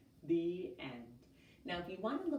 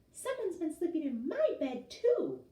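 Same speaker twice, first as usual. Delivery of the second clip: higher, loud cry, stress on my; crying out Mama Bear; 11 semitones higher, 7 dB louder than usual. A narrator's reading. Mama Bear finding her bed slept in, higher loud cry. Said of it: "Mama Bear cried".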